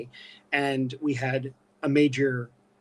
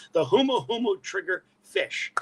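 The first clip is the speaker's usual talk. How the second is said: slow, higher "humu humu trigger fish", each word set apart; careful self-correction; 5 semitones higher, 4 dB louder than usual. Real, not acted. Biologist fixing his tongue-tied fish name, slow and careful. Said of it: "I can't talk".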